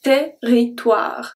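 Territoire said very slowly, oi as wa; slow pronunciation demo. Shown as a demonstration. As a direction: drag out Territoire so they hear the wa. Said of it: "is pronounced as wa".

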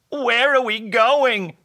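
high, loud, drawn-out question; bewildered cry. A narrator's reading. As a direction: call out in hammed-up confusion. Todd dragged out the door.

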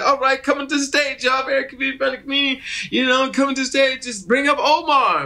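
high-pitched imitated MC introduction; stage announcer. Club host bringing Omar on stage, mock announcer.

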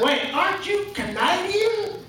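high, drawn-out mock disbelief; incredulous. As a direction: question him slowly in a high, puzzled voice. Redneck catches him out, incredulous.

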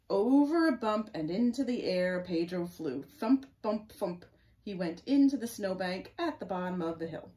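loud, dramatic picture-book narration; story read-aloud. Acted. Reading Pedro's sled crash to children, loud and dramatic. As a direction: punch out the bumps and thumps.

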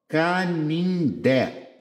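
Canindé drawn out slowly with the weight on the final dé; teacher sounding out an oxytone; stressed final syllable. Shown as a demonstration. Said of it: "Oxítona terminada em E".

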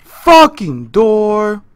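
loud, high, drawn-out exasperation; frustrated yell. Real, not acted. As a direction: stretch the curse out in frustration. Stuck at a door in game.

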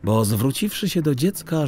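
ordinary delivery, nothing marked

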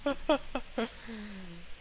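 high breathy nervous giggle; a small anxious laugh. Real, not acted. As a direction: laugh off the fear. Nervous laugh mid boss fight.

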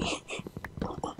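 breathy sinister snicker; whispered evil giggle. Acted. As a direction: giggle under your breath.

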